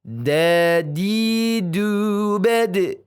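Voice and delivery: "Dadidu bad" stretched out slowly and loud; slow dal drill. Shown as a demonstration. Teacher drills the dal letter for the class, slow and stretched. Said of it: "pelafadzan huruf dal".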